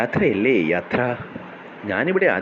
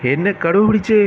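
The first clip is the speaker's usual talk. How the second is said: higher, louder cry for help; panicked cry; 8 semitones higher, 3 dB louder than usual. Acted. A rabbit being chased cries out in panic. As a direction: yelp it out in panic.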